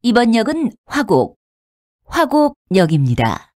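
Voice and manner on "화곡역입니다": normal talking voice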